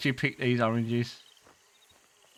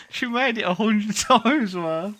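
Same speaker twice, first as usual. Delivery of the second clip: laughing; 5 semitones higher, 4 dB louder, slower than usual. Real, not acted. Laughing about a game character.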